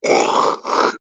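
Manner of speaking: harsh phlegmy cough; wet cough. Real, not acted. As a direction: hack it out roughly. Well, that was gross.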